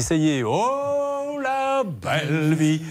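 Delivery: slow drawn-out ecstatic sigh; dreamy delight. Retold or imitated. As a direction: savour the good life, slowly. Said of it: "lui, il s'est dit".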